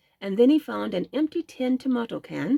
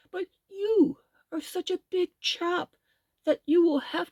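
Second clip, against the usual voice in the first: a hint of a careful old gentleman, slow; gently worried old rabbit, unhurried; lightly voiced old rabbit; 6 semitones higher than usual. A narrator's reading. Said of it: "spoke the old gentleman rabbit".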